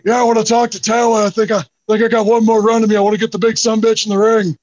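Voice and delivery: mock Stone Cold challenge; Steve Austin impression. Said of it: "I did my Steve Austin impression".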